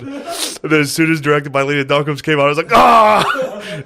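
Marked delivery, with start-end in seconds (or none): 2.7-3.8 loud, high startled yell; mock scream. Reacting to the director's credit, loud mock scream. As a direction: let out a high scream-laugh cry. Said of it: "I started scream laughing".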